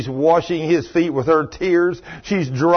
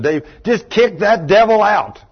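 firm, louder push on "kick"; forceful urging; 6 semitones higher, 5 dB louder than usual. Retold or imitated. Preacher urging sick Dave, firm and emphatic. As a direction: press Dave hard to kick that devil out.

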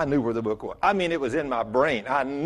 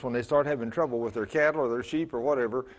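ordinary delivery

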